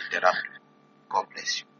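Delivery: ordinary talk, nothing marked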